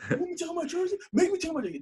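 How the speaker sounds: very high, strained mock-macho challenge; Hulk steroid frat boy. Drunk dude daring friend over his jersey, hammed-up frat boy. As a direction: imitate a frat boy hulking out. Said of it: "like, Hulk steroid frat boy".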